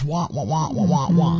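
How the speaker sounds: lightly voiced muffled wah wah of the cartoon teacher; Charlie Brown adult voice. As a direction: mimic the cartoon grown-up's droning wah. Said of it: "I was in a Charlie Brown cartoon".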